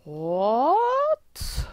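loud, drawn-out "what" swooping way up; exaggerated disbelief, voice shooting upward; acted-out astonishment. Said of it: "I just looked at it like".

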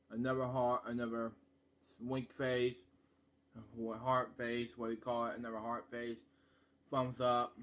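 muffled behind hands; through cupped hands. Real, not acted. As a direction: hand covering mouth. Seen 1: Both hands cupped over mouth, later one hand covering mouth.

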